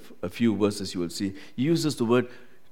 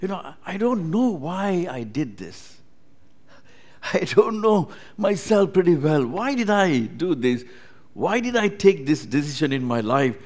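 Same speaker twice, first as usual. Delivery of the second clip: puzzled believer; 6 semitones higher, 3 dB louder than usual. Retold or imitated. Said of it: "Moments in our life we say".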